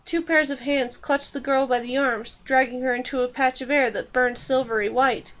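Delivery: ordinary delivery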